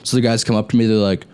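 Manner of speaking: ordinary delivery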